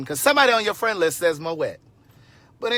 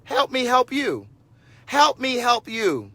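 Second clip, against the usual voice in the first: slow, higher, hitting each help; pleading emphasis; 7 semitones higher, 4 dB louder, slower than usual. Retold or imitated. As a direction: spell it out slowly, help me help you. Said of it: "I said".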